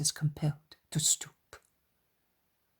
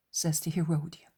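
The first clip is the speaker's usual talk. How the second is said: usual speaking voice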